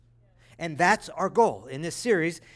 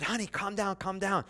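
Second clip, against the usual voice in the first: much softer, gentle calming; soothing dad; 2 semitones higher, 7 dB quieter than usual. Retold or imitated. Father calming his crying daughter, soft and soothing. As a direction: hush a panicking child. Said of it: "I said".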